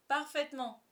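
high-pitched, emphatic agreement; exclaiming. Acted. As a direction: exclaim it eagerly, as if in chorus. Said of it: "s'exclamèrent-ils en chœur".

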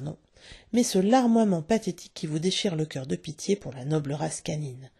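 ordinary delivery, nothing marked

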